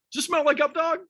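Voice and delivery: very high, insistent prank question; mock-innocent, pitched right up; doubling down. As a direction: imitate a joker refusing to drop the bit. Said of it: "just stuck with it, doubled down".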